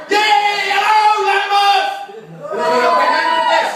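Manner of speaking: high, drawn-out full-voiced yell; real rock-frontman shout to a crowd; crowd greeting shout. Real, not acted. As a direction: holler it out to the whole room.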